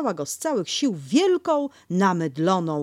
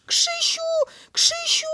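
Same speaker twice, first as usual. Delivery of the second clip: much higher squeaky call; tiny pig crying for help; 5 dB louder than usual. Acted. Piglet calling Christopher, much higher. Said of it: "zawołał prosiaczek".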